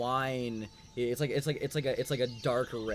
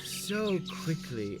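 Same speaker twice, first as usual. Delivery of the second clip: slow, slightly higher, drawn-out words; queen's voice; 5 semitones higher, slower than usual. Acted. Queen to departing guests, slow mock surprise. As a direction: linger on it like a slighted hostess. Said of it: "you hear another voice".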